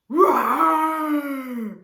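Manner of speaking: long, very loud lion roar; best lion roar. Pretend. Practising the story cue with children, huge lion roar. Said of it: "best lion with that roar".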